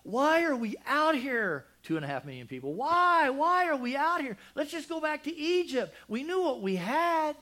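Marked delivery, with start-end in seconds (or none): 0.0-1.6 high, loud, slow whine; whining Israelites. Retold or imitated. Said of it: "they're just like". 2.7-7.4 very high, loud whining complaint; whining Israelites. Retold or imitated. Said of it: "whining and crying".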